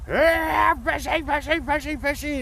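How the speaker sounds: high, strained puppet yell; frustrated screaming. Retold or imitated. Bert frustrated yelling for fish, high loud Muppet yell. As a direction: scream the call high and fed up. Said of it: "he's screaming".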